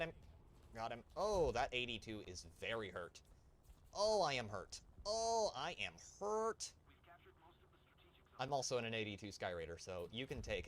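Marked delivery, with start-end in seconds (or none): 3.9-4.8 loud, higher "oh, I am hurt"; mock hurt cry. Gamer playing up a hit in his plane, mock hurt cry. 5.1-6.7 slow, high-pitched playful wail; drawn-out mock hurt. Gamer milking the damage to his plane, drawn-out mock wail.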